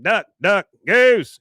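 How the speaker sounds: high, loud playground chant rising to goose; playful shout. Retold or imitated. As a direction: yell it like a kid's game. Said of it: "he's like".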